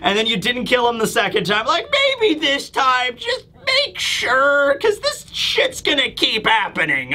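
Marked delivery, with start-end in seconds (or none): 2.2-7.1 high, strained mock shout; exasperated plea. Townsfolk begging Kuma to finish him off, exasperated.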